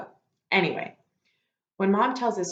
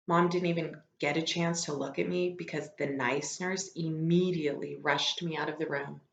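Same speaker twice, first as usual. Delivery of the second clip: ordinary delivery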